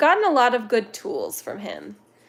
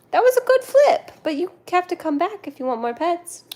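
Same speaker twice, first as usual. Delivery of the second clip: high-pitched praise for a pet; pet talk; 8 semitones higher than usual. Real, not acted. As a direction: coo high at an animal.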